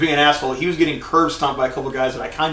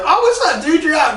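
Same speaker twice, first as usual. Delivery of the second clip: high-pitched, delighted mock hello; excited retold greeting; 13 semitones higher, 4 dB louder than usual. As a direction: greet a long-lost buddy, hammed up. Retells greeting friend out of prison.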